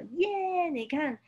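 high delighted yay; excited cheer. Acted. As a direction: squeal it for the child. Cheer over new raincoat.

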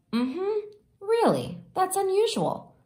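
listening to the teacher on the phone; mother taking a call from the teacher; mom on the phone. Acted.

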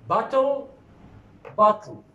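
bottle said high, then with a falling tune; bottle, first careful, then reduced; bottle contrasted. Shown as a demonstration. Teacher contrasts the two forms of the word. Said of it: "regarding this word".

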